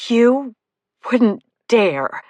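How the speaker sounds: every word hit hard and loud; decisive emphasis. A narrator's reading. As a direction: lean on each word of "You wouldn't dare". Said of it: "with decisive emphasis on each word".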